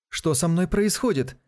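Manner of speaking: startled frightened shout. Acted. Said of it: "крикнул он удивлённо и испуганно".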